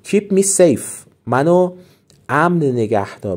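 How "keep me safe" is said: slow raised model. Shown as a demonstration. Teacher models phrase.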